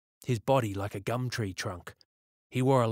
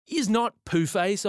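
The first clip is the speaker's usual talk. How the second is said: put-on high, loud child's retort; indignant little sister; 10 semitones higher, 6 dB louder, slower than usual. A narrator's reading. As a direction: squabble like a small girl with her brother. Little sister contradicts her brother.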